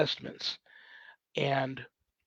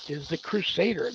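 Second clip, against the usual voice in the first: ordinary talk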